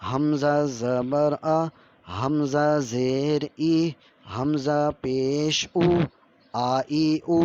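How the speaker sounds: slow, clear teacher's recitation; Qur'an reading teacher drilling vowel sounds. Shown as a demonstration. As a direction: chant each syllable slowly for students to repeat. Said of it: "اب ہم پڑھتے ہیں، آپ غور سے سنیے".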